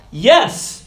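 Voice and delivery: high, loud eager yes. Retold or imitated. Accepting the coworkers' fried food, a high eager yes. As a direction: jump at the offer. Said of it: "And I was like".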